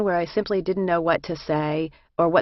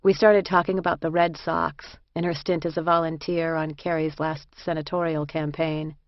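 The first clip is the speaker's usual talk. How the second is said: plain reading voice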